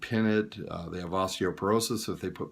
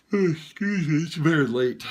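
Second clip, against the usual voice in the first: yawning; 7 semitones higher, 4 dB louder than usual. Real, not acted. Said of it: "I'm a yawner".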